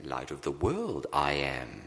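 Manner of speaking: lightly voiced low, inverted proverb; low pitch, landing firmly on "I am"; Yoda. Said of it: "hear it as Yoda would say it".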